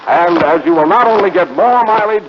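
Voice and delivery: ordinary talk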